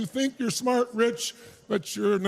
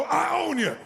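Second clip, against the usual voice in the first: higher, shouted I own you; shouted challenge; 6 semitones higher, slower than usual. Shown as a demonstration. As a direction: throw down the challenge.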